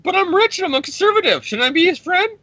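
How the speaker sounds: very high, squeaky, pleading voice; Tony begging Dick Cheney on a call, squeaky and high; whiny Tony Stark. Retold or imitated. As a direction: whimper it like a nervous kid. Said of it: "he's on a call with Dick Cheney".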